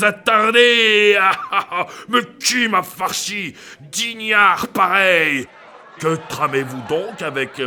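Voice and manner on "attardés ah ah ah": mocking laugh. Crier mocks them laughing.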